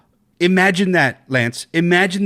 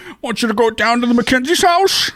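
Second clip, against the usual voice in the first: imitating Lori; 11 semitones higher, 3 dB louder, faster than usual. Quoting Lori in the original.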